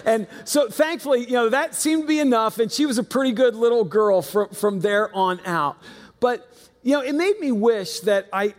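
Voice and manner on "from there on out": normal talking voice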